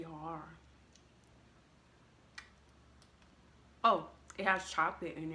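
biting hard candy; talking while chewing; mouth full of candy. Real, not acted. Seen 2: Bites small candy held at teeth 1.6–2.7s, then talks while eating.

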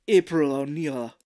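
put-on loud, muddled French-Japanese accent; hammed-up villain slipping into French accent; Japanese accent turning French. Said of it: "why he's turning French now".

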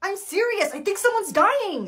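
panicked plea; urgent high cry for help. Acted. Passenger pleading with attendant.

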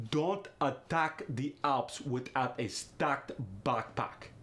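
slow, thick Russian accent; Russian accent with ah in "attack" and "backpack". Shown as a demonstration. [name] tries the practice sentence, slow Russian accent. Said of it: "Try this sentence in different ways".

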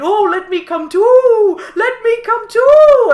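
loud, high, slow excited owl cry. Acted. Owl begs the animals to take him, excited. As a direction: beg to come along, thrilled. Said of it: "the owl was so excited to see it".

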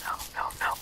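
whispered no no no; frightened whisper. Real, not acted. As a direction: whisper in dread. Dreading a monster.

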